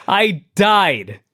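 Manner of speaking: usual speaking voice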